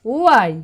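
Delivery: উআই called out as one smooth oo-eye sound; loud blended pronunciation. Shown as a demonstration. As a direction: model উআই loudly for the class. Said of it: "একসঙ্গে উআই". (জোরে এক টানে উআই)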